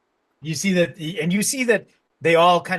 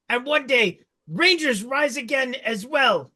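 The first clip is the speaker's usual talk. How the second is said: goblin king's proud speech; 7 semitones higher than usual. Acted. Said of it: "And he goes".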